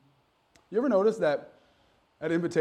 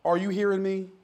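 Southwestern dialect; 2 semitones higher than usual. Shown as a demonstration. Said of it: "in your Southwestern dialect".

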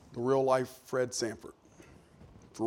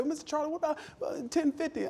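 high-pitched imitation of a haggling customer; put-on higher voice, feeling out a price; 13 semitones higher, 4 dB quieter than usual. Customer to Mister Charlie over ten dollars, haggling voice. Acts customer making a counter offer.

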